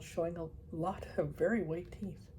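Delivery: plain reading voice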